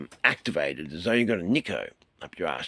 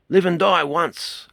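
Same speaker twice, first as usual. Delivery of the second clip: proclaiming; 11 semitones higher, 10 dB louder, slower than usual. Shown as a demonstration. Declaring a kung fu teaching.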